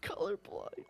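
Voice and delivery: breathy whispered echo. Real, not acted. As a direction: whisper the word back. Echoing question in whisper.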